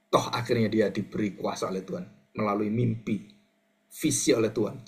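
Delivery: normal talking voice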